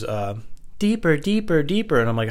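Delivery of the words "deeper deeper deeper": high put-on girlfriend voice; mock woman's voice.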